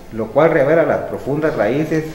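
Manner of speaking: ordinary talk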